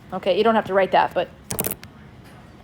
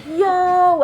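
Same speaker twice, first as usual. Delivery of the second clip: higher, louder, slow cartoon voice of eager nerves; excited nerve cells; 6 semitones higher, 4 dB louder, much slower than usual. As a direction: play the nerves hyped that food is coming. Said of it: "They're like".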